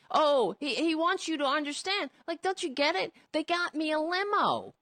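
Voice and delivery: high, whining mock excuse; whiny mock voice. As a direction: whine it up high like a pathetic excuse. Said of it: "that's what it sounds like to me".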